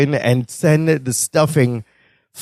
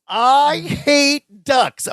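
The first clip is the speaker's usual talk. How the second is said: higher, very slow mock hatred; Balky hating ducks; 8 semitones higher, much slower than usual. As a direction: stretch it out like a sulky foreigner.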